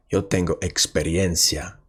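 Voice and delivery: ordinary talk, nothing marked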